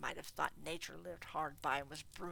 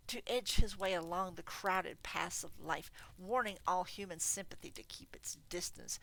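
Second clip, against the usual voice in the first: usual speaking voice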